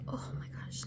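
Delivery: real hushed whisper of awe; awed whisper. Real, not acted. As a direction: whisper it like you can't believe it. Awed by close-up glitter.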